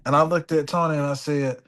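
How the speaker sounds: usual speaking voice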